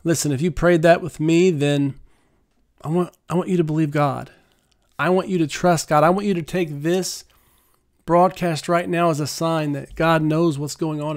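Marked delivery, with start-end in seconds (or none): none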